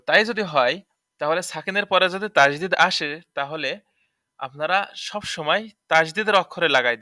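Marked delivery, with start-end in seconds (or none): none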